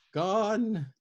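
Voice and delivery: put-on high, loud grieving old woman; old woman grieves the lost girls, high and loud. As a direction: let the grief carry the word. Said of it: "she grieved".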